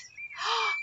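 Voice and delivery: breathy mock gasp; sharp, surprised intake of breath. Teacher spotting a number for children, gasping.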